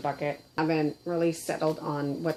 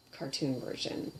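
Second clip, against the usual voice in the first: ordinary talk, nothing marked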